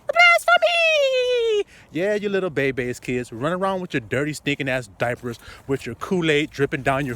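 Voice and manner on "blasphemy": drawn-out, high piercing cry of blasphemy; shouted accusation. Acted. Preacher crying out at the congregation, high and drawn out. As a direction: shout the word out, stretched long.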